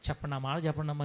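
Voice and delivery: ordinary delivery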